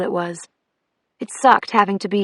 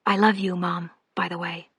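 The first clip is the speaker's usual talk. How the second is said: soft whisper; 2 semitones lower, 7 dB quieter than usual. Acted. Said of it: "She whispered in my ear".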